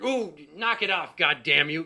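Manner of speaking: mock angry swearing. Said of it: "she always swears at you".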